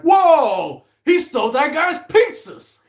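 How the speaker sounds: loud, high-pitched mock outcry; high, booming movie-quote holler; shocked bystander shout. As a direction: yell it like an outraged passerby.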